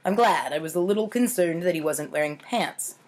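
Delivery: ordinary delivery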